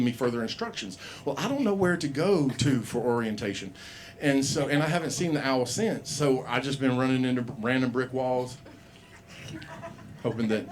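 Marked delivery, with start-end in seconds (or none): none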